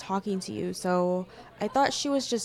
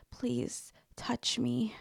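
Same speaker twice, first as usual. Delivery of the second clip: whisper; 5 dB quieter, slower than usual. Acted. Intimate whisper.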